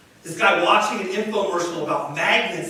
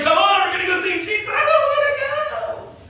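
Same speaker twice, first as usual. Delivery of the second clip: hammed-up protest; 12 semitones higher than usual. Friends drag reluctant man.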